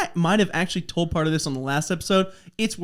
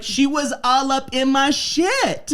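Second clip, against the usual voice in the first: mock girl; 7 semitones higher, slower than usual. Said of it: "She's like".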